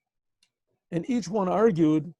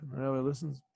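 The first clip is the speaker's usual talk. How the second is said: normal talking voice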